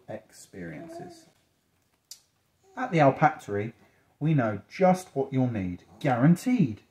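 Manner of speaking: reassuring storybook sales pitch, key words lifted; reading to children. A narrator's reading. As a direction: promise help to a young listener. Reads picture book to child.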